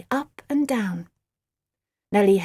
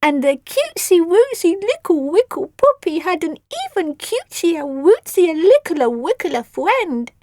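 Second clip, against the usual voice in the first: put-on baby talk; 8 semitones higher, 5 dB louder than usual. Sugary baby-talk story.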